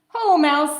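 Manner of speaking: high, loud cheery call; hare voice. A narrator's reading. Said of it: "says Hare".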